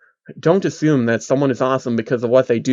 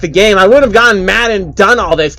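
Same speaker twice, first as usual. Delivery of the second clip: high, louder incredulous whine; whiny man-child; 5 semitones higher, 4 dB louder than usual. Acted. Phil blames narrator.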